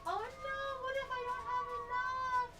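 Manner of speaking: very high, soft wail; worried whine. Real, not acted. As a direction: fret in a small, high-pitched voice. Worries about running out of sugar.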